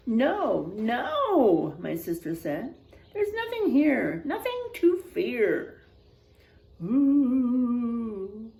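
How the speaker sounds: expressive storybook voice, quotes acted out; reading to children. A narrator's reading. Teacher reading a spooky picture book to the class, expressive. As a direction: read it like a spooky bedtime tale for kids.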